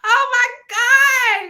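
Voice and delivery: loud, very high, drawn-out squeal of delight; thrilled exclamation. Retold or imitated. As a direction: act out being floored by meeting a fan. Retold reaction to meeting a reader.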